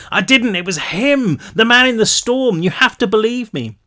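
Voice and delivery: frantic pleading. A narrator's reading. Brick begging to be believed.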